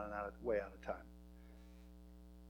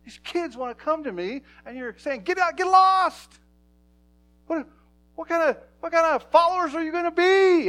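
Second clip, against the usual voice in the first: outraged rebuke; 18 dB louder than usual. Retold or imitated. Jesus scolds the disciples for turning children away, loud and high. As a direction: thunder at the disciples, pitched way up. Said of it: "he looked at the disciples and went".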